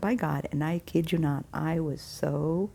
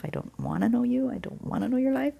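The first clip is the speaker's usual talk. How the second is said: insistent protest; 8 semitones higher than usual. Retold or imitated. Woman telling the boss man she wants no part, insistent. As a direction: pitch climbing in mock exasperation.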